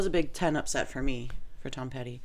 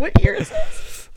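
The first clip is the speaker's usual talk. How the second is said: mock bewildered; 9 dB louder, slower than usual. Mock confusion about the year.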